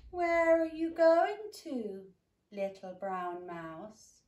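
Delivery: high, loud, slow owl; owl, hooting. Acted. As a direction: hoot it slowly from above. Owl asks mouse.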